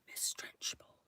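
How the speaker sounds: a soft breathy whisper of Miss Trenchbull. Shown as a demonstration. Whispered repeat of name.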